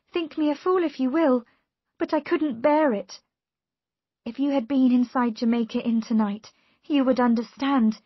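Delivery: high, loud, insistent pleading; distressed niece. A narrator's reading. Voices Mary pleading in distress.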